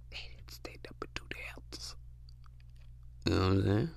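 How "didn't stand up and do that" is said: whispered aside. Real, not acted. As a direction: breathe the words without voice. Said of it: "whispered aside".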